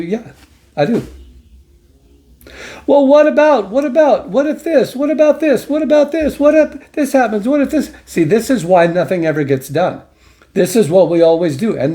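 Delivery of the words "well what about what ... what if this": high, fretful mocking voice of the doubters; mock whiny objector. Mocking the people who always object, high and fretful. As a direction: mimic the nervous what-ifs, pitched way up.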